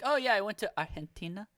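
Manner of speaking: "Argentina" with a Spanish soft h for the g; quick, quiet mimic stressing a Spanish "Argentina"; affected Spanish pronunciation. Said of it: "they do it like that".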